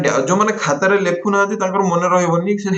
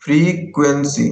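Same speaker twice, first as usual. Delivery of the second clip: Frequency stretched at half speed; slow dictation; much slower than usual. Shown as a demonstration. Teacher writing the next topic for students, slow dictation. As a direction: draw out frequency chunk by chunk.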